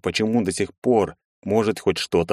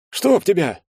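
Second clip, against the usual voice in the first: higher, squeezed-out curse; forced-out curse; 11 semitones higher than usual. A narrator's reading. Andrey swears in shock after the gunshot. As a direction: blurt it out in shock. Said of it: "только и смог выдавить Андрей".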